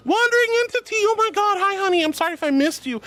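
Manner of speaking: high-pitched gushing hello; excited greeting. Real, not acted. Streamer welcoming a returning viewer, real excited greeting. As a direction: squeal the name, then settle slightly.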